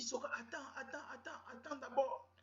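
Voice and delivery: high, urgent pleading voice; urgent girlfriend. Retold or imitated. Girlfriend urging her man to wait, high and urgent. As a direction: beg him to wait, high. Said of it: "elle me dit".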